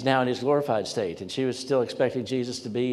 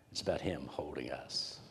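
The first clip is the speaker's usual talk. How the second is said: whisper; 7 semitones lower, 10 dB quieter than usual. Real, not acted. Preacher's intimate point.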